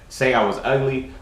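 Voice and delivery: usual speaking voice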